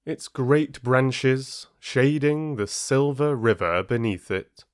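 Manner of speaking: gentle, measured storyteller reading a fable; calm fable narration for listeners; story narration. A narrator's reading. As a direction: tell it slowly like a bedtime fable. Reads fable aloud.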